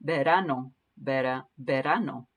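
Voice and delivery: repeated teacher demo of "verano"; Spanish V as B. Shown as a demonstration. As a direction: model "Verano": be-RA-no. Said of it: "practice the V, sounding like a B".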